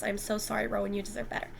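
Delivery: usual speaking voice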